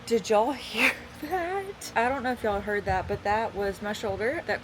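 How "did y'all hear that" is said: higher, slow, laughing disbelief; surprised laughing. Real, not acted. Reacting to shoulder crack.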